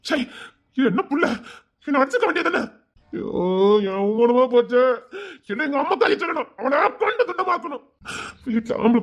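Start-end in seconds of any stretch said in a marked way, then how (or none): 0.8-6.5 shrill angry outburst, pitch climbing; furious high rant. Acted. Furious rant at shamed sister. 6.6-7.8 shouted threat. Acted.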